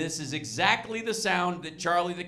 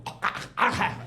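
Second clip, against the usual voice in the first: quick imitated dog hacking; dog retching. Comic showing how dogs puke, imitated retching. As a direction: hack it up like a sick dog. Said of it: "they just go".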